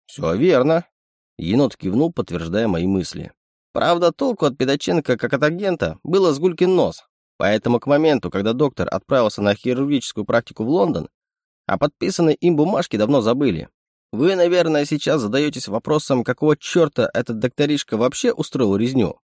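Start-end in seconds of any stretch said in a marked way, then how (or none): 0.1-0.9 lightly raised, teasing raccoon voice; a sly little animal sidekick; sly chittering raccoon. Acted. Raccoon speaks. 3.7-19.2 lightly raised, teasing raccoon voice; a sly little animal sidekick; sly chittering raccoon. Acted. Raccoon explains.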